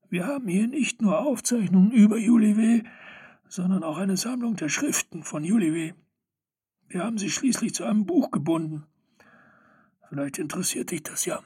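high, quickening gnome chatter; old gnome voice. Acted. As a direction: rattle it off like an eager old gnome. (hohe, eilige Gnomstimme)

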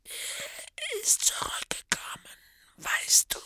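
high, slow mad whisper; crazed whisper. Acted. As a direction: confide it like a lunatic. Morphin secretive.

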